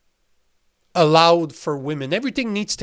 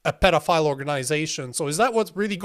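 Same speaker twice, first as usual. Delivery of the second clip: ordinary talk, nothing marked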